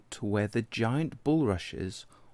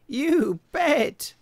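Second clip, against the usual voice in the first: much higher, louder lamb voice; the lamb boasts to Tadpole, much higher and louder; 7 dB louder than usual. Acted. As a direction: brag it in a bright lamb squeak. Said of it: "said Lamb".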